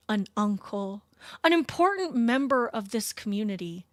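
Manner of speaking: slow, solemn and soft eulogy, hammed-up; mock solemn sermon voice; mock funeral preacher. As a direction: play a pompous pastor opening a eulogy gently. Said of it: "imagine being like".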